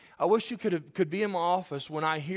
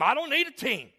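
higher-pitched cocky quarterback comeback; mock self-assured player; cocky retort; 8 semitones higher than usual. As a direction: brush the question off, voice pitched up. Acts out cocky teamless quarterback.

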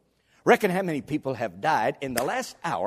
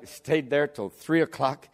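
ordinary talk, nothing marked

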